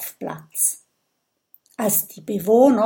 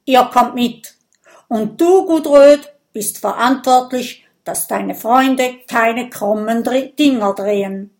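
loud, firm order; commanding; 3 semitones higher, 7 dB louder than usual. A narrator's reading. Viking leader orders the friends along, commanding. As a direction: lay down the law as chief. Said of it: "und befiehlt".

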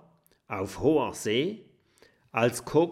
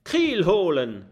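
pirate shout; 8 semitones higher, 8 dB louder than usual. Acted.

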